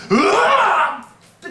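long, high, loud scream of fright; a drawn-out yell that cracks into a laugh; terrified yell. Retold or imitated. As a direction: cry out in horror at the ghost. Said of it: "って声を上げた".

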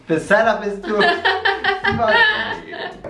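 high, drawn-out words through laughter; laughing. Real, not acted. Friend squeezed into a photo setup, laughing. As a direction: laugh your way through the complaint.

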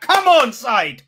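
loud cry of encouragement; yelling at the screen; urging shout. Real, not acted. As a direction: urge the team on. Urging player during attack.

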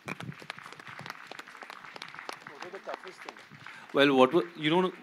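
ordinary talk

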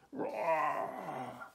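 dinosaur roar, drawn out; storyteller's mock bellow. A narrator's reading. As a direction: roar like a T-Rex. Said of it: "bellowed Trevor".

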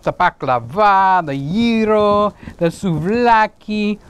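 put-on slow, savoring Greek accent. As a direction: draw out the dishes in a Greek accent. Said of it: "I love it when they say it like that".

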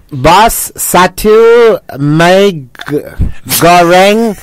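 halting, put-on British accent. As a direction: order regally in a British accent, word by word. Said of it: "try ordering a mee goreng mamak in a British accent".

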